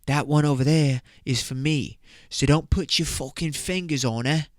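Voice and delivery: put-on British lad's accent, rising in pitch to a threat; hammed-up British warning to back off; mock British accent. Lad warns his mate off a girl, mock British accent. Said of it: "So that's how they say that".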